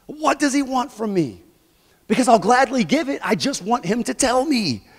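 high, pleading and exasperated; exasperated asking. Acted. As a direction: beg God to just tell you. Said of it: "he's sitting here like".

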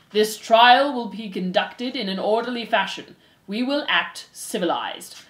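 slow, measured, pompous authority; stern head mouse. Acted. Head mouse opens the trial, stern and formal. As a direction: pronounce each word as law.

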